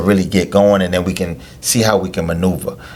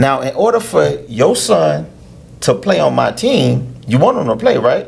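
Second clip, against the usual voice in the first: higher mock voice of a greedy coach; sarcastic trainer voice; 5 semitones higher than usual. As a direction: play the money-hungry trainer, sarcastic. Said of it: "I'm being sarcastic".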